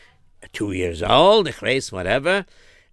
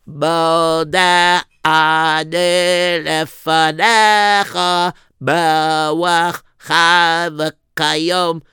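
very slow, syllable by syllable; halting beginner reading; 4 semitones higher, 4 dB louder, much slower than usual. Retold or imitated. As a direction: sound out each word. Child reading slowly.

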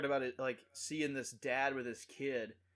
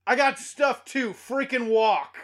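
very loud, high exasperated yelling, laughing at the end; angry dad yell; 12 semitones higher, 17 dB louder, slower than usual. Retold or imitated. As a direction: bark at the kid like an angry frat brother. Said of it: "looks at his son and goes".

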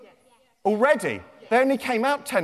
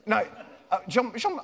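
ordinary talk

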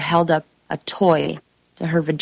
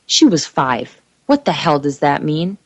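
ordinary talk

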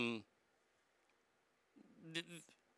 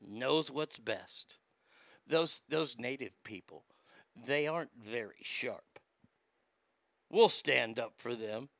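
lower, softer smug reporter voice; condescending reporter; 8 semitones lower, 7 dB quieter than usual. Retold or imitated.

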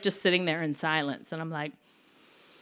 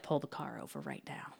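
whispered, seething order; retold angry demand; 4 semitones lower, 14 dB quieter than usual. Ordering the boyfriend to stop the car, whispered fury. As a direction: hiss it through the anger.

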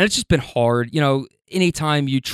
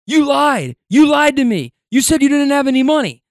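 retold angry shout; 10 semitones higher, 5 dB louder than usual. Angry stranger accuses him.

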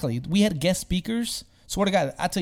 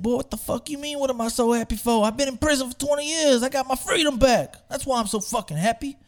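a touch higher, lightly voiced ex-prisoner; imitating the ex-con; 7 semitones higher than usual. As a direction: retell his proud comeback to the bus driver. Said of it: "He said".

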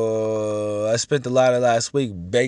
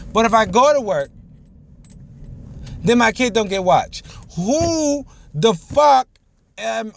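angry rant; 9 semitones higher than usual. Real, not acted. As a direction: hammer the disbelief. Ranting about work and school.

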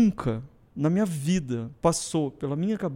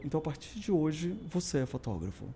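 hushed solemn verdict; 3 semitones lower, 9 dB quieter than usual. Retold or imitated. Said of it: "ficou aquele silêncio na cozinha".

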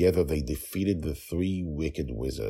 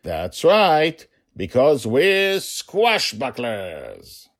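loud, high, last word stretched out; triumphant father building to a cry; heroic, slow and emphatic; rallying cry; 9 semitones higher, 7 dB louder, much slower than usual. A narrator's reading. Father rousing daughter to battle.

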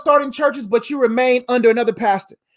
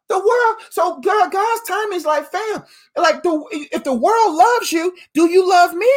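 higher, rising to a shout; fervent preaching; 6 semitones higher than usual. Real, not acted. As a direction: build into a passionate cry.

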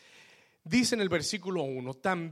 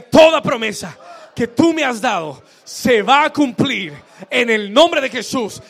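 higher, much louder full-force shout; shouted proclamation; 7 semitones higher, 19 dB louder than usual. Shown as a demonstration. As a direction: roar the promise to the church. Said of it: "Ahora diga".

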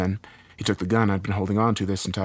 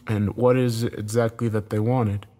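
plain reading voice

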